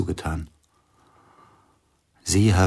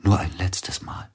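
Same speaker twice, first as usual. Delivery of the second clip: whisper; 3 dB quieter than usual. Acted. Intimate whispered confession scene.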